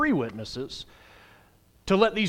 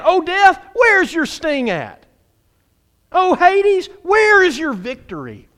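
loud, high taunt all the way through; trash talking; 10 semitones higher, 5 dB louder, slower than usual. Acted. Preacher acts out scripture taunting death and Hades, trash talk. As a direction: call out death like a rival, slowing on the question. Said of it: "it's basically some trash talking".

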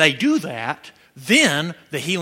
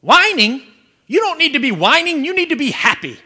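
high, loud Indian-accented mock scolding; Jerry, Indian accent; 8 semitones higher, 7 dB louder than usual. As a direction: imitate an Indian minister earnestly correcting a caller. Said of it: "precious little Indian guy named Jerry".

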